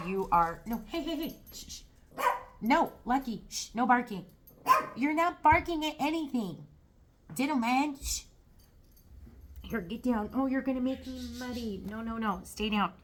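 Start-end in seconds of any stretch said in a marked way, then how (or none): 0.7-1.8 quick high shushing at the dog; hushing a pet. Real, not acted. Dog barking during reading. 2.6-8.2 high firm scolding of a dog; hushing a pet. Real, not acted. 9.6-12.9 hushing a pet. Real, not acted. Pushing dog down.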